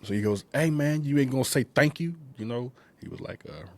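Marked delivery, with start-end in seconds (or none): 0.5-2.1 a high, lightly voiced buddy calling out; Chucky's way of talking. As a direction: imitate the friend asking for thanks. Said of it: "how he was saying it".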